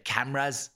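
"Cameras" with a falling end; Irish cameras. Shown as a demonstration. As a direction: say "Cameras" with a downward inflection. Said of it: "All these French people go back".

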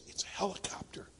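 very soft secretive whisper. Acted. Little boy explaining the noise, whispering. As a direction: whisper the answer like a small boy. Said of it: "And again whispering".

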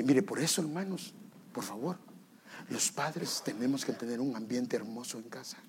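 ordinary delivery

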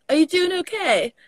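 retold British-sounding check-in; British accent. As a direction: let a touch of British slip into the question. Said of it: "too U K for them".

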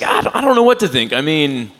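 hammed-up impression of his fretting mother; worried mom. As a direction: squeak out mom's panic, half laughing. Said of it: "she's like".

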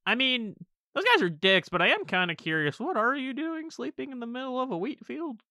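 stranger's voice. Acted. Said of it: "he's like".

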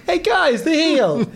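high, loud call to the whole room; mock incredulous appeal. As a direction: appeal to everyone as if he's the idiot. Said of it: "I turned to everyone as though he was the idiot".

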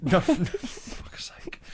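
high, slow whisper breaking into laughter; laughing whisper. Pretend. Quoting [name] to a friend while giggling in a whisper.

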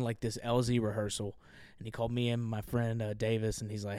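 ordinary talk, nothing marked

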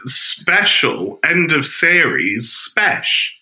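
slow show-opening announce; slowed playful show-open announcement. Real, not acted. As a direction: drag out the special intro. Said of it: "a lot of spes in that".

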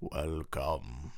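low eerie whisper from nowhere; disembodied voice. Pretend. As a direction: breathe it like an unseen spirit. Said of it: "Where is that voice coming from?".

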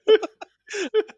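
high, loud, laughing through the question; offering it while cracking up; squeaky laughing do you want them. Retold or imitated. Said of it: "and I was like".

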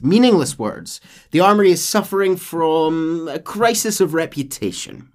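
loud, high scornful officer; De Santa warning John about rebel talk; scornful captain. Acted. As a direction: sneer at the peasants' words. Voicing De Santa.

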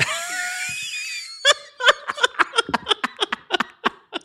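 a big, high burst of laughter; loud laughing shout. Real, not acted. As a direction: crack up loudly.

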